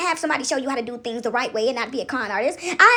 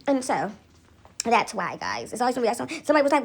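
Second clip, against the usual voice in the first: ordinary talk, nothing marked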